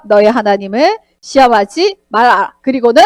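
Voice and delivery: usual speaking voice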